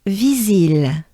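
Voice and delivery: "visil" with two smiling i sounds; French pronunciation. Shown as a demonstration. Teacher drilling "visil" with a banana smile. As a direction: smile wide through both i's of "visil". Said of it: "let's take a good breath".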